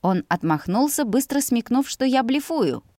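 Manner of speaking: plain reading voice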